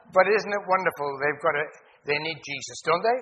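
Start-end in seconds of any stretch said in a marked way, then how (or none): none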